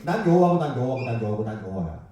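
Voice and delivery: calm, flat repeating that fades away; God's blunt repetition, fading. Retold or imitated. Preacher imitating God's answer to Moses, blunt and fading. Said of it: "이런 화법이에요".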